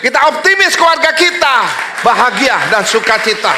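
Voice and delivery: loud, high-pitched sermon shout; full-force preaching. Real, not acted. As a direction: proclaim it to the whole congregation at the top of the voice.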